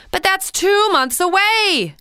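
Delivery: put-on high, slow whining girl voice; a girl whining, high and drawn out; whiny Christy. A narrator's reading. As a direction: draw it out in a childish whine. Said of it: "Christy whined".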